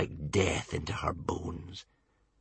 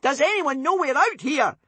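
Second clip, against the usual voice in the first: put-on high worried boy voice; anxious squire crying out; 4 dB louder than usual. A narrator's reading. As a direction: call it out in alarm. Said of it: "cried Bodkin".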